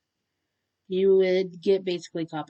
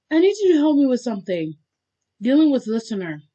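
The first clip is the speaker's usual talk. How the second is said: loud, raised-pitch request; calling out; 6 semitones higher, 8 dB louder than usual. A narrator's reading. Bakugo calling his friend to help, loudly. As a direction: project it to someone far away.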